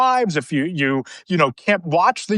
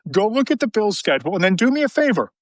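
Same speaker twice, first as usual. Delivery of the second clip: ordinary delivery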